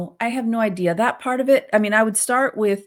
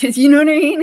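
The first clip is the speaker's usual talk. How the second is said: laughing, higher and louder; 7 semitones higher, 9 dB louder than usual. Real, not acted. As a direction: laugh it out to your co-host.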